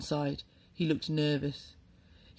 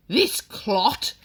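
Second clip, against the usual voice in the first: loud, higher booming scorn; booming insult; 6 semitones higher, 8 dB louder than usual. A narrator's reading. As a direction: boom it at the whole school. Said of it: "boomed headmistress".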